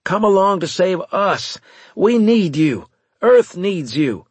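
loud, high begging, slowing at the end; desperate plea. A narrator's reading. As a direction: beg for help with full force. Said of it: "feeling desperate".

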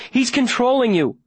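high-pitched urgent cry; shouted warning. A narrator's reading. Viola warning Davy about his father, shouting. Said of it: "Viola shouts".

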